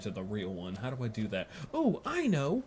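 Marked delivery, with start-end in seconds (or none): 1.7-2.6 high squeaky cartoon voice; bad voice acting. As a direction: ham it up in a silly high voice. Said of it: "I gotta do the bad voice acting".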